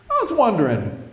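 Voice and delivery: high-pitched, casual lead-in; Rich. Retold or imitated. TV station regular approaching deli worker, high voice. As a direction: imitate a friendly high-voiced guy. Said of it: "this one guy named Rich".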